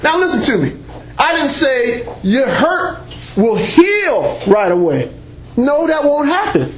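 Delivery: ordinary talk, nothing marked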